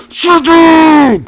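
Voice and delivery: sudden piercing high scream. Retold or imitated. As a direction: shriek it at him, losing it completely. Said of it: "she screams at him".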